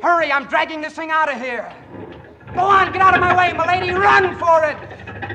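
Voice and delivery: high, loud, urgent shouting; frantic yell. Acted. Soldier yelling at the lady while dragging the bomb. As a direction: bellow over the engine noise.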